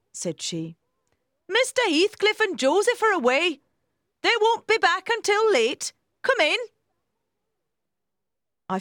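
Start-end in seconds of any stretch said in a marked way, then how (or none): 1.5-6.6 high, loud housekeeper character voice; helpful housekeeper, reassuring. A narrator's reading. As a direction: pipe up loudly as the helpful housekeeper.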